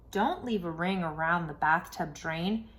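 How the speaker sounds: plain reading voice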